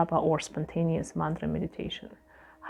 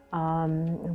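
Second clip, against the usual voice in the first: ordinary delivery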